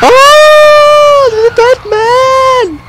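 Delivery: long, very high shriek; hammed-up scream. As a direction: scream the words slowly, way up high. Comes upon a dead man.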